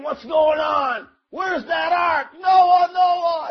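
panicked shouting. Acted. Drowning crowd shouts for Noah in panic. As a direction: yell for Noah as the rain pours down. People panic as flood begins.

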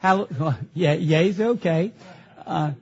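ordinary talk

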